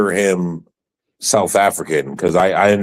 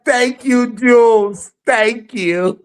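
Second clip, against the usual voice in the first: high, bright mock thank-you; high mock gratitude; 13 semitones higher, 4 dB louder, slower than usual. Mock thanks after the clip.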